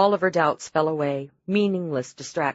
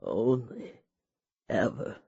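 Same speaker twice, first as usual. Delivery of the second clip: faint dying voice; 5 semitones lower, 7 dB quieter, slower than usual. A narrator's reading. Dying husband struggles to speak.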